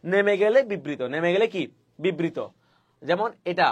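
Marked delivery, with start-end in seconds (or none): none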